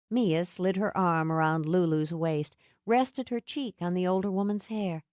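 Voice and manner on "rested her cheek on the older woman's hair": ordinary delivery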